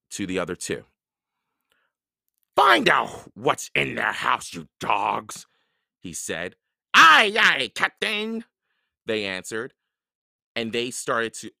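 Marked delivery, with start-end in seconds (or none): 2.6-5.5 rough pirate order, bursting out loud; Captain Bones. A narrator's reading. Captain Bones orders his two men, domineering. 6.9-8.4 high, loud pirate acknowledgement; pirate henchmen. A narrator's reading. Two pirates obey their captain, loud and eager.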